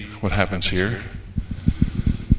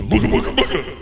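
high, laughing booga booga; a hammed-up harmless bogeyman; mock spooky noise; 7 semitones higher than usual. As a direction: tease him with a silly monster noise. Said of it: "all he can do is go".

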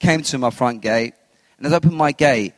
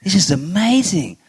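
rising higher in amazement, lightly acted; awed wonder; 5 semitones higher, slower than usual. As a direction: gasp of wonder at a strange place. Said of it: "And I looked and I thought".

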